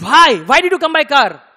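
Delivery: loud, high, indignant demand; acted-out angry interrogation; angry guru. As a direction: shout the question like a scolding master. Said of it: "got more angry".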